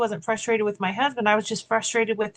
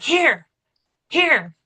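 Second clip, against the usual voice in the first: curt; 5 semitones higher, 4 dB louder than usual. Retold or imitated. Handing coffee while annoyed.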